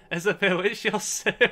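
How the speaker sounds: loud, high, laughing exclamation; delighted shout through laughter; laughing greeting. Real, not acted. Jokey greeting amid laughter.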